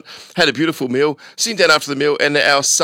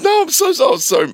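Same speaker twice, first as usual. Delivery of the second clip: flustered protest; 3 dB louder than usual. Retold or imitated. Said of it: "I'm like".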